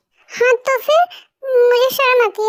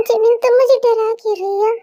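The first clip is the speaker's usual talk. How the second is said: ordinary delivery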